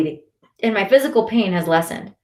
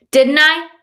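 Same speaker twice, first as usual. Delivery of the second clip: much higher, louder yell; 9 semitones higher, 7 dB louder than usual. A narrator's reading. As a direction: shout the question at him. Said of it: "Shirzad yells".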